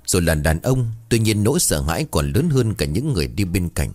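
ordinary delivery

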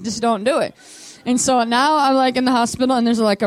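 ordinary talk

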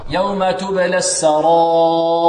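slow recitation, long held aa in السرائر; four-count madd. Shown as a demonstration. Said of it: "كم مقداره للإمام قالون؟".

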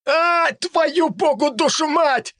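very high, slow howled curse; pained yell. Acted. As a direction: howl the curse in sudden pain. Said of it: "раздался возглас".

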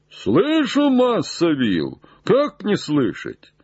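high, drawn-out reply; calling back. Acted. Jupiter answers Legrand from the tree, high call.

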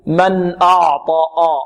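ع in أعطى darkened like the ط; heavy ayn. Shown as a demonstration. Teacher warns against this recitation error, heavy ayn. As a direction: thicken the ayn and opening vowel of أعطى. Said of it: "ونحذر من تفخيم العين".

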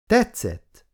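TETSZETT pitched high, falling firmly; confident. A narrator's reading. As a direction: answer TETSZETT high and sure of it. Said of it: "mondta Jane magabiztosan".

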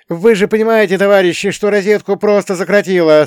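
high, loud insistent question; high-voiced boy questioning security; asking the guards. A narrator's reading. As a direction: pitch it up, pressing the guards. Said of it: "спросил я охрану".

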